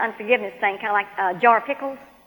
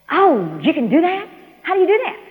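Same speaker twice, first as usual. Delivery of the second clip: higher-pitched wondering question; amazed questioning; 7 semitones higher, 4 dB louder than usual. Retold or imitated. Woman asks God how to release hurt, amazed. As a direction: ask as if it is news to you. Learns that she can release.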